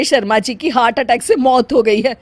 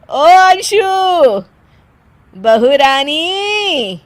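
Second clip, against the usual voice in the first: calling out; 5 semitones higher, much slower than usual. Acted. Calling daughter-in-law from another room.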